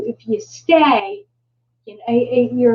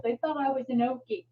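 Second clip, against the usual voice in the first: usual speaking voice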